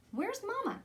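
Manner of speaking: put-on a small girl asking where her mother is; child's voice. Little girl Tanya asks Papa.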